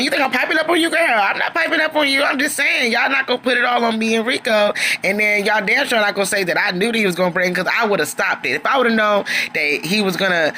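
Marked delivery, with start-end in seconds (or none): 0.0-4.7 higher, sassy clapback; sassy retort. Retold or imitated. Narrator answering Troy's accusation with a higher, sassy clapback. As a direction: snap back at him, pitched up and sassy.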